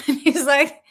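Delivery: ordinary talk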